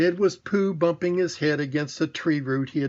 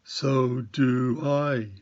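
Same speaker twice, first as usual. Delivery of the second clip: very slow, lower bear voice; a slow, contented old bear; Pooh; 4 semitones lower, much slower than usual. A narrator's reading. Pooh answers Christopher Robin, very slow and lower. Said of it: "said Pooh".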